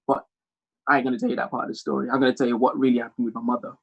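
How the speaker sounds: ordinary talk, nothing marked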